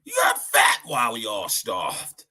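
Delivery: shouted gangster line; mock gangster shout; quoting the gangster. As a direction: throw the accusation like the movie. Reciting the movie line.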